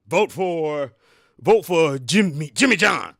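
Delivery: loud, pushy vote-for-Jimmy pitch; shouting at a passer-by to vote for Jimmy John; pushy campaigner. Retold or imitated. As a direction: hustle a stranger for his vote, loudly. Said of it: "walk up to someone and say".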